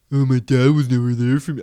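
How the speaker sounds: loud, garbled mock guy voice; mocking caveman voice. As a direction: mimic him derisively, loud and inarticulate. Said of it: "like, mocking me".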